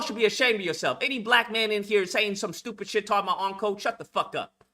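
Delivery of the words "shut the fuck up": usual speaking voice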